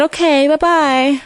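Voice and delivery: put-on high, level goodbye of a story child; lilting character farewell; light sing-song goodbye. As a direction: pitch it up for a storybook bye-bye.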